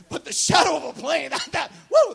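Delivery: loud, high, laughing exclamation; excited shout. Real, not acted. Preacher marvels to the congregation, excited shout. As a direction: shout it out in delight.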